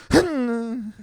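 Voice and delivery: very high mock voice; squeaky high voice. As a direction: pitch it way up playfully. Customers ignoring, mock narration.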